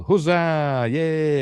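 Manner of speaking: high, drawn-out hooray; mock cheer. Reviewer cheering the trapped alien, mock-jubilant. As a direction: stretch out a playful cheer.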